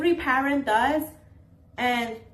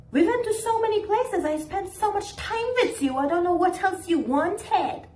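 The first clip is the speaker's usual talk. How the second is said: much higher, defensive mock parent voice; 8 semitones higher than usual. Mocking a parent answering a lonely grown child, high defensive. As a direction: protest like a parent who feels wronged. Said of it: "and they be like".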